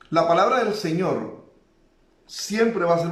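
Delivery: preacher urging the hurting to take heart; fervent preaching. Acted. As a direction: press the comfort home to the listeners. Preacher exhorting the congregation.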